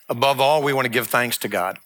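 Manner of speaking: ordinary talk, nothing marked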